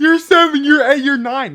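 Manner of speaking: put-on high, loud parody British accent; mocking British imitation. Parroting the guest's school years, loud mocking British accent. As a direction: squawk it like a caricatured Brit.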